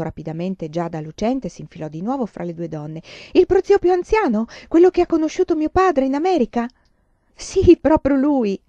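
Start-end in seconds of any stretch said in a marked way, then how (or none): 3.3-6.7 much higher, louder astonished questions; amazed child. Acted. Child amazed about great-uncle. 7.3-8.6 slow, higher, louder; happy confirmation. Acted. Mother confirms happily.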